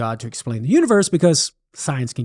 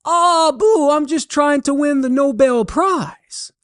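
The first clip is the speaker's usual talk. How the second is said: much higher Cajun answer, earnest; Cajun holler; 11 semitones higher than usual. Acted. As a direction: call back proudly in a high Cajun voice. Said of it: "Thibodeau hollered back".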